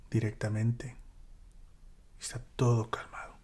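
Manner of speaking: whispered softly; hushed calm. Acted. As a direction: breathe the word out in a hush. Describe la calma tras tormenta. (susurrado con calma)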